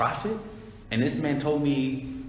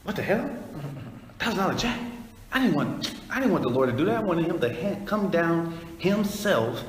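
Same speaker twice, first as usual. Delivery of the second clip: higher, offended whining at an unwanted gift; mock the ungrateful man's outrage; indignant complaint; 6 semitones higher than usual. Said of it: "But a wicked person be like".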